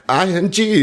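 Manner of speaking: imitating the contestant nailing the spelling in a bright Minnesota accent. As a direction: finish the spelling proudly in a Minnesota lilt. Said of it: "all bright in that Minnesota accent".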